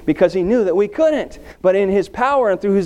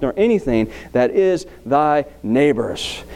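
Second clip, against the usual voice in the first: measured, solemn reading of the commandments; scripture reading; 4 semitones lower than usual. Acted. Preacher reading the Ten Commandments aloud to the congregation, solemn and measured.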